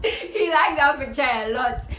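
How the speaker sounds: giggling through the words; laughing while she tells it. Real, not acted. Laughing about the pink picture.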